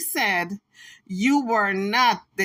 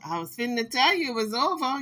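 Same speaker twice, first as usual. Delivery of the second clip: normal talking voice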